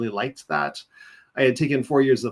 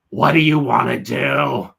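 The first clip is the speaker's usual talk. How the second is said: slow, louder put-on voice of a weary career counselor; Roz voice; 7 dB louder than usual. Career counselor asking a lost student her plans, slow put-on voice. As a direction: drag each word out like a bored clerk. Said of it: "she sounded like Roz in Monsters".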